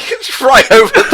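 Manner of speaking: high, laughing, breaking voice; laughing squeal. Real, not acted.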